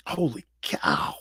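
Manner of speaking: breathy hushed "Holy cow"; awed whisper. Retold or imitated. Whispering in awe at his first NFL locker. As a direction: whisper it in disbelief.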